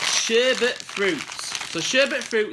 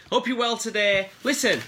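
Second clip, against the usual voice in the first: ordinary talk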